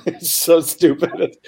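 real laugh through the words; cracking up at a dumb joke; helpless giggling, slow and high. Real, not acted.

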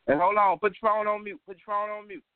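loud, high, sharp command to mute; yelling order. Real, not acted. Host on the call line yelling at a caller, loud and high. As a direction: bark at them to mute now.

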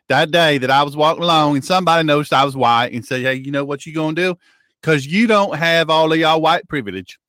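hammed-up Southern yokel telling a tall tale; folksy Southern simpleton. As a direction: play a bewildered country bumpkin on the radio. Continues folksy Southern character.

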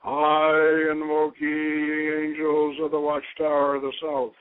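grave ceremonial calling of the watchtower; ritual invocation. Real, not acted. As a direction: proclaim it to the angels of the south.

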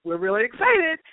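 lightly voiced eager universe; mock excited universe. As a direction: play the universe revving up with delight. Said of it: "the universe is like".

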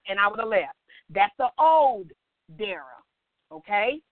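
ordinary delivery

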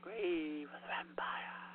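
slow eerie whisper. Pretend. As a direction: hush it like a secret. Whispered repeat of title.

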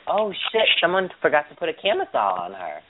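high-pitched retold shock; mock alarm. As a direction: replay the startled realization, playfully. Acts out shocked reaction on seeing her.

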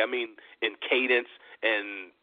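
ordinary delivery

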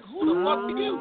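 high, startled question at the door; shocked at who opened the door; shocked retort. Retold or imitated. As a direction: recoil in mock horror. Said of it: "I'm like".

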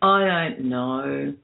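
slow, drawn-out doubt; retold sceptical thought, stretched out slowly; doubtful musing. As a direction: draw it out, unconvinced. Retelling own sceptical thought.

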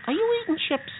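high, disbelieving scolding; can't believe what she's doing; exasperated. Acted. Friend eats chips mid-rescue.

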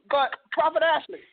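normal talking voice

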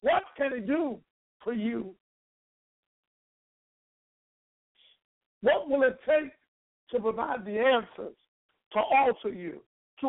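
rising, fervent preacher cadence; preaching. Acted. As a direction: build the preaching up. Preaching climb, higher pitch.

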